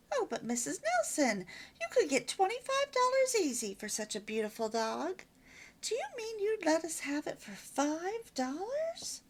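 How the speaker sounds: a surprised mother bargaining on the phone. Acted.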